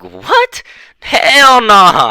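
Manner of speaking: high squeaked "what", then a loud no; incredulous refusal. Retold or imitated. Student refusing to return to the office, incredulous. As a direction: shoot way up in pitch, disbelieving and loud. Retelling his refusal of her request.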